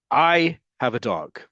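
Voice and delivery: "I" leaned on, slow and deliberate; slow model sentence with "I" stressed. Shown as a demonstration. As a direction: land hard on "I" in "I have a dog". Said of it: "notice who gets the emphasis".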